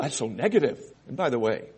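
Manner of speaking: normal talking voice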